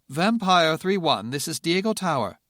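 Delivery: lightly voiced British controller; crisp British radio voice; British accent. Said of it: "the British tower controller".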